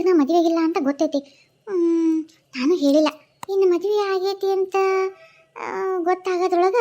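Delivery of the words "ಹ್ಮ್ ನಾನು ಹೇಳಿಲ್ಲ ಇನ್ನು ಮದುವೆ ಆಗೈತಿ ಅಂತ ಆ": ordinary talk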